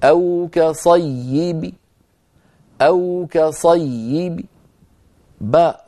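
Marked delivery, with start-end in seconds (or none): 0.0-4.4 slow recitation of "كصيب" with the final ba kasra whispered faintly; stopping with rawm. Shown as a demonstration. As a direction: let the kasra on the ba of "كصيب" fade to a weak trace. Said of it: "ويكون النطق هكذا". (وقف بالروم على "كصيب" بكسرة ضعيفة الصوت)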